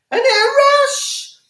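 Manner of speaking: very high, drawn-out mock squeal; sarcastic thrill. Host mocks twenty-first-century life to viewers, sarcastic thrill. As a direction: squeal it slowly in fake delight.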